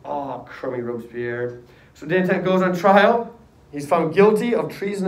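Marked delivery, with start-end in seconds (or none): 0.0-1.6 low, soft, slow grumble; resigned grumble. Acted. Danton learning who betrayed him, resigned grumble. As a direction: grumble it slowly, let down.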